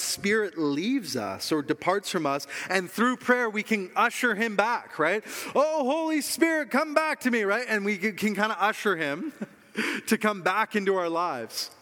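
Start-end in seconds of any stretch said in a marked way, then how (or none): 5.5-7.4 high-pitched mock plea, louder than talk; mock pleading. Preacher parodies believers ushering the Spirit back, mock pleading.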